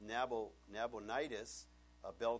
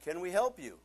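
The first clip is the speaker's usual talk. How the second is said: mock polite; 7 semitones higher, 10 dB louder than usual. Greeting odd visitor at church.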